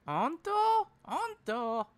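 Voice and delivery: very high, squeaky disbelief; mimicking a gullible, astonished streamer; Miko. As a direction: ask in a high put-on voice, pitch leaping up. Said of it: "she's like".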